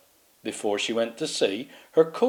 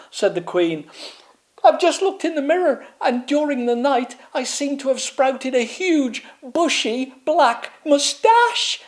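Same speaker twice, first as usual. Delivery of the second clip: very high, wailing royal voice; distraught queen. Acted. Queen wailing to her maid about a moustache, very high. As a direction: lament it dramatically.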